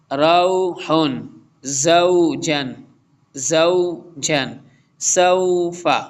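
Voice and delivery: slow careful recitation, तऊ said short, not stretched; short vowel recitation. Shown as a demonstration. Teacher reciting slowly for the students, keeping the vowel short. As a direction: chant each letter combination slowly with a short ending. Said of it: "ये सब word ऐसे है".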